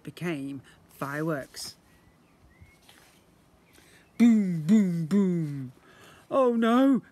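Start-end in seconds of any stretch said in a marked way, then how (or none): 4.2-5.7 booming fireworks. A narrator's reading. Narrator booms out the fireworks, loud. 6.3-7.0 loud, higher cry of dismay; alarmed exclaim. Acted. Boy panics at the gunk monster, loud cry. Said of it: "exclaimed the boy".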